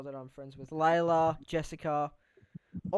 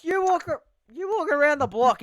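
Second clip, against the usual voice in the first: much higher, louder teasing; loud mockery; 11 semitones higher, 10 dB louder than usual. Real, not acted. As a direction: cut him off loudly to mock him. Mocking his friend's walking.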